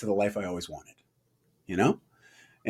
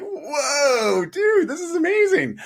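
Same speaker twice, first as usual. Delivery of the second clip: much higher, near-crying "Whoa, dude"; emotional high-pitched disbelief; ecstatic; 12 semitones higher, much slower than usual. Retold or imitated. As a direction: squeal it out in overwhelmed joy. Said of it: "I was like".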